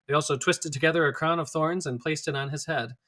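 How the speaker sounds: ordinary delivery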